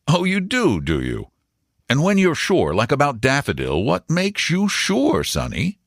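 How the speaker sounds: lightly voiced, teasing uncle; a hint of a questioning older man; amused uncle. A narrator's reading. Uncle quizzes the boy about his tips, lightly voiced.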